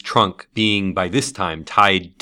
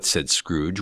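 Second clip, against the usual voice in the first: ordinary delivery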